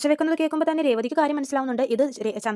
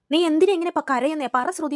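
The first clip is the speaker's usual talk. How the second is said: loud, much higher anxious demand; urgent pleading; 5 semitones higher, 7 dB louder than usual. Retold or imitated. Husband demanding why his wife is crying, loud and urgent. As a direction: press her for an answer, raising the voice.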